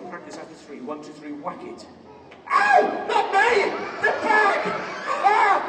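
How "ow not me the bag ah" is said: high, loud yelps and protests; hammed-up agony, shouted high; comic pained yelling. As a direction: yell as if you've just been whacked. Whacked instead of the bag.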